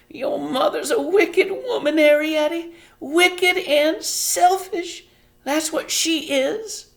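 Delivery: high, quavering, tearful, slowing down; a mother near tears calling herself wicked; trembling voice. A narrator's reading. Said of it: "Homily's voice began to tremble".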